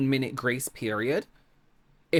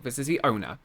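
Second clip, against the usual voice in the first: ordinary talk, nothing marked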